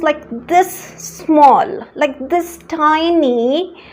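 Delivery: very slow this small, this tiny; slow emphatic tiny; drawn-out small. Shown as a demonstration. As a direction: stretch each word to show how small. Showing a tiny problem again.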